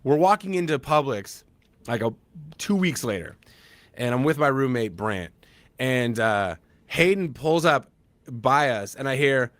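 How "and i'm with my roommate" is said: ordinary talk, nothing marked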